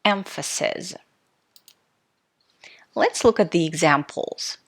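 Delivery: ordinary talk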